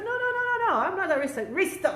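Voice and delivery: high, insistent mock protest; retold denial. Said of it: "I said".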